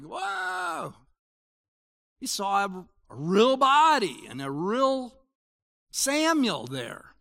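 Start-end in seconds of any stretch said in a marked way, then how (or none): 0.0-0.9 startled whoa. Retold or imitated. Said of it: "Saul saw him and is like".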